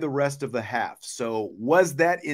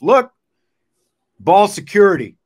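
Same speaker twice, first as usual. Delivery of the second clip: louder, punchy call for ball security; emphatic; 5 semitones higher, 12 dB louder than usual. Real, not acted. Host hammering home ball security, loud and firm. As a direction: bark it out firmly.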